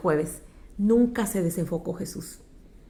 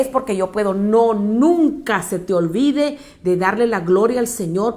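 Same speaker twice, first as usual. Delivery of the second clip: louder preaching to the congregation; fervent preaching; 3 semitones higher, 4 dB louder than usual. Acted. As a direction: urge the church not to lose focus. Exhortación a la congregación. (predicación fervorosa)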